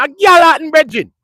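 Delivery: high, loud mock Jamaican correction; Jamaican accent. Jamaican friend correcting him, in a loud high Jamaican accent. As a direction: insist on Galhattan like a proud Jamaican, in his accent. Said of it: "That's what my Jamaican man said".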